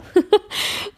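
a short, high, sheepish laugh; embarrassed laugh. Real, not acted. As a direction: laugh it off at yourself. Laughs at her own filler words. (kurzes, verlegenes Lachen über sich selbst)

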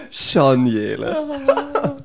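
laughing through the words. Real, not acted. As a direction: say it while still laughing. Host echoes guest, still laughing.

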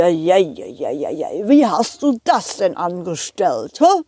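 lightly voiced fussing driver; train driver voice. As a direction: fuss over the lace as driver. Driver struggles with lace.

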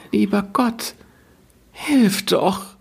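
put-on a desperate little girl crying out; wailing girl. Gretel praying while carrying water, high and tearful. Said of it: "rief sie aus".

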